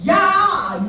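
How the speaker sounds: high, drawn-out irritated "야!"; annoyed yell. Shown as a demonstration. Showing how it sounds aimed at a neighbour, irritated shout. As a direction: snap at someone with a long, high yell. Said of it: "짜증내는 소리가 되는 거예요".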